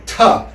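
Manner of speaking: "ta" tapped on the roof of the mouth; a crisp "ta" from the palate; palatal ta. Shown as a demonstration. Teacher isolating the palatal "ta". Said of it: "when you say".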